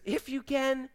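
high, soft questioning echo; incredulous echo. Acted. Jesus throwing the father's words back.

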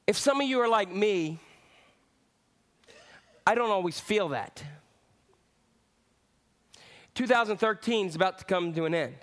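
normal talking voice

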